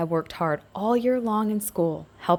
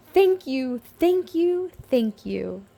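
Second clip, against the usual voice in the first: grateful tiny letters; 6 semitones higher, slower than usual. A narrator's reading. Saved letters' message.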